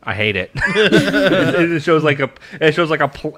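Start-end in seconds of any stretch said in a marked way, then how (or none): none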